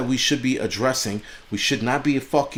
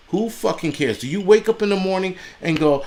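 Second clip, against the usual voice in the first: ordinary delivery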